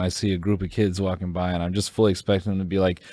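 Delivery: ordinary talk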